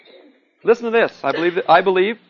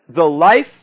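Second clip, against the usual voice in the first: louder, weighty opening words; grand pronouncement; 8 dB louder than usual. Acted. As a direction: declaim it like a lawyer in court. Lecturer reads Snoopy as courtroom lawyer.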